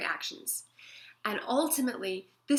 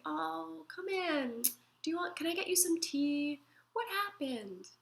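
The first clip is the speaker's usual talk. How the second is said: tender welcome; 8 semitones higher than usual. Pretend. Welcoming a crying friend in.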